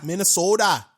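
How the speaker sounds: echoed mock Minnesotan; hammed-up Minnesota O; Minnesota accent. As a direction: playfully chime in with a Minnesota accent.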